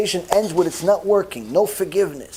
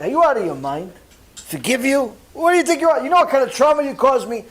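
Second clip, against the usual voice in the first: indignant son-in-law; 7 semitones higher, faster than usual. Retold or imitated. The son-in-law scoffs at the caller's plea for forgiveness, high and indignant. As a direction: fire back at the apology, outraged. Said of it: "his son-in-law is like".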